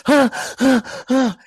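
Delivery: high, breathy huffs like an exhausted dancer; imitating panting. Dancer catching breath after the audition, high panting. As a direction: pant hard after the big move.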